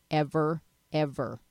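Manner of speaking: usual speaking voice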